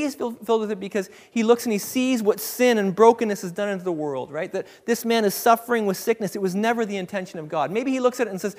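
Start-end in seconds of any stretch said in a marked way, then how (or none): none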